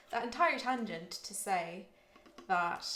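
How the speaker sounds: put-on playful posh London accent; British accent. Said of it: "okay, dropping the accent now".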